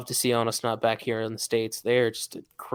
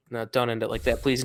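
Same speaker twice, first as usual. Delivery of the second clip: usual speaking voice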